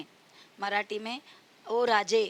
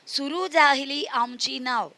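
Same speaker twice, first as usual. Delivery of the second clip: put-on sing-song, louder recital of a children's poem; poem recitation; 3 semitones higher, 6 dB louder than usual. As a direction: recite the verse loudly for kids. (बच्चों को कविता सुनाते हुए, ज़ोर से गाकर पढ़ना)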